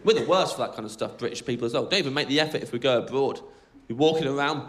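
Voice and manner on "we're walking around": normal talking voice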